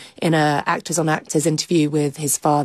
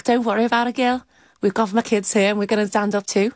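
put-on higher-pitched Scouse accent; Liverpool accent; 5 semitones higher, faster than usual. Fan behind her at the gig telling her not to worry, Liverpool accent. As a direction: reassure a stranger in lilting Liverpudlian. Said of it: "a very good Liverpool accent".

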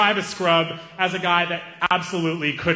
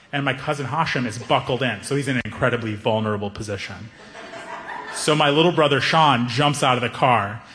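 usual speaking voice

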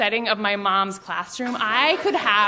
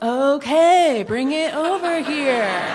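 slow, louder singsong willingness; exaggerated, drawn-out innocent okay; oblivious eagerness; 3 semitones higher, 3 dB louder, much slower than usual. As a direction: agree cluelessly, stretching every word out. Oblivious reply, exaggerated on repeat.

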